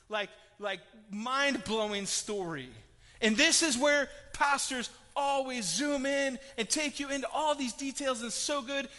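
loud, rising preacher's drive; full-force preaching. Acted. Pastor urging the congregation about the story, loud preaching. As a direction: build the crowd up to the climax.

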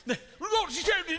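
high, loud hammed-up British accent; bad British accent. Old British lord at the table, bad British accent. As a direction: play a blustering British lord, badly. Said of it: "I can't do a very good British accent".

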